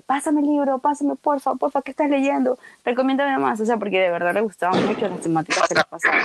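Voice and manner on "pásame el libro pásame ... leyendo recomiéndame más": high, insistent imitation of friends; pleading friends. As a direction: mimic friends begging in a raised voice. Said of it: "sí me frecuentaban bastante". (amigas insistentes, imitadas con voz aguda)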